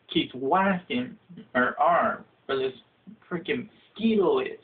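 normal talking voice

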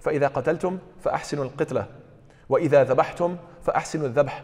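fast, practiced Arabic recitation; rapid hadith recitation. Acted. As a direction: rattle off the memorized hadith.

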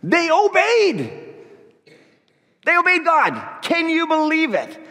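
high, slow, awed delivery; mock astonishment, drawn out high; amazed emphasis. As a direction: marvel at it from high up.

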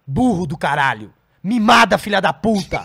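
loud exasperated insults; loud scolding. Acted. Berating comics who only complain, loud and scolding.

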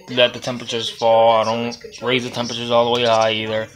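normal talking voice

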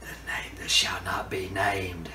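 spooky whisper. Acted. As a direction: breathe it out in an ominous whisper. Ominous Voldemort reference.

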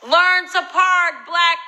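high, loud, slow yell with a word tacked on; drawn-out mock shout; shouted worse version. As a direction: bellow the imagined note to the last word. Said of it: "They could have said".

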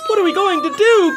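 high, loud, panicked cry; distressed cry. Acted. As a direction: wail it in despair. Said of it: "cried Missus Cream".

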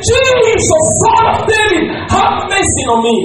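high acted cry for Jesus; shouting. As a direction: shout it out far above speaking pitch. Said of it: "he shouted".

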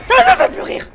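very high, very loud outburst; real scared, fed-up shriek; frightened yelling. Real, not acted. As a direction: scream the words in panic. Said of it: "C'est terrifiant de gueuler comme ça".

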